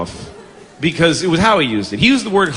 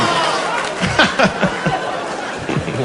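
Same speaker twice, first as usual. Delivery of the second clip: long, softer breathy giggling fit; laughing; 6 dB quieter than usual. Real, not acted. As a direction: wheeze with laughter at yourself. Cracking up at own outburst.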